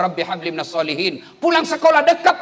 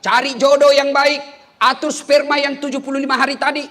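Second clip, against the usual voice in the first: high, loud preaching at full force; impassioned sermon voice; fiery preaching; 10 semitones higher, 5 dB louder than usual. Acted. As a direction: drive the point home to the congregation.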